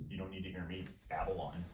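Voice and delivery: low, soft self-deprecating aside; going quiet. Real, not acted. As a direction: drop your voice and trail away. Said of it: "I'll be quiet".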